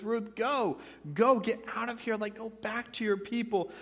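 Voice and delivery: mild mock urging to leave; Naomi urging. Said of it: "She says".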